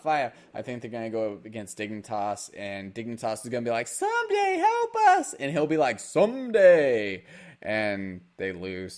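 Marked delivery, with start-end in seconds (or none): 3.9-5.3 very high squeaky cry; mock plea for help. Team begging its player to rescue them, high mock plea. 6.0-7.2 loud, drawn-out reply; mock heroic answer. Player answering his team's cry for help, loud drawn-out mock call.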